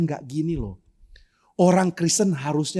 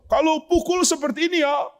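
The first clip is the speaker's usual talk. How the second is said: karate coach; 12 semitones higher than usual. Retold or imitated. Karate teacher demonstrating.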